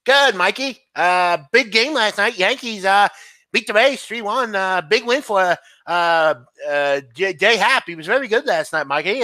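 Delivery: high, excitable sports radio host; hammed-up high-pitched radio impression; Mad Dog Russo. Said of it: "can I do Mad Dog Russo?".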